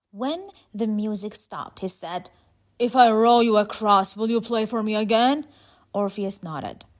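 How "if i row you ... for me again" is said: loud, bargaining ferryman voice; Charon the ferryman. Acted. Charon offers Orpheus passage for music, forceful. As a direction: put the offer forcefully, rising at the end.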